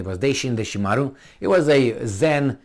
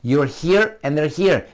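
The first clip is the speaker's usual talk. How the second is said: ordinary talk, nothing marked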